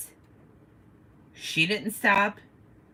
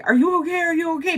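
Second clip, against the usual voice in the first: concerned bystanders; 12 semitones higher, 4 dB louder, faster than usual. Retold or imitated. Bystanders asking repeatedly.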